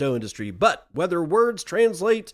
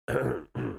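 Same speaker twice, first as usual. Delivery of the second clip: rough throat clear; 6 dB quieter than usual. Real, not acted. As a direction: clear your throat hard. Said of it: "Sorry, I'm choking to death".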